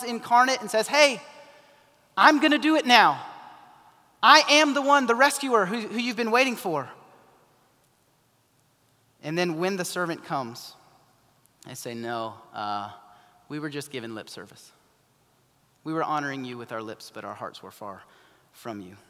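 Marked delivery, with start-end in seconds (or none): 0.9-6.9 higher and louder, bursting with eager news; eager proclamation. Acted. Jesus telling his people he's the rescuer, eager and loud. 11.9-19.0 sheepish admission. Acted. Servants admitting empty lip service.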